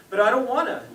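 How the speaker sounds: playfully acted childish protest; pouting child. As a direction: whine back like a sulky kid. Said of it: "then started the pouting".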